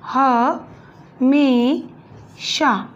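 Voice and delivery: ह, मे, शा split with pauses; syllable by syllable. Shown as a demonstration. Teacher spelling हमेशा for students, syllable by syllable. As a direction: tap out each syllable. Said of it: "ए की मात्रा आएगी".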